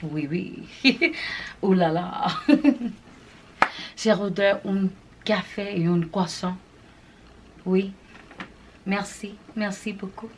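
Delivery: playful put-on French accent with giggles; mock French accent. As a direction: play a tourist in Paris with a French accent. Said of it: "That's how I feel right now, darling".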